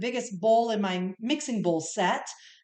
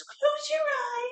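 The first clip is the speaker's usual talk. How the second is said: very high, slow squeaky fairy; slower than usual. Acted. Younger sister hiding that it's her, squeaky fairy voice. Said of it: "So I used my fairy voice".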